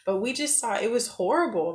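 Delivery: ordinary delivery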